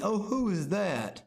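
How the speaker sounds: high, drawn-out feigned curiosity; hammed-up puzzled act; put-on acting. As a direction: play clueless, stretching the words high. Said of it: "but they're acting".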